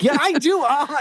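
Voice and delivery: very high, comic protest; mock insistence. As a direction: squeak out a mock insistent retort. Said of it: "he literally is like".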